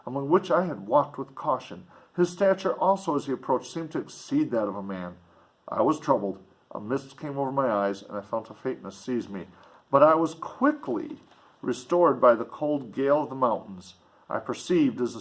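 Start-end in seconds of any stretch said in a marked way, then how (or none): none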